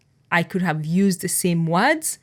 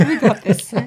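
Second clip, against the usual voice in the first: laughing; 5 semitones higher, 5 dB louder than usual. Real, not acted. Echoing with a laugh.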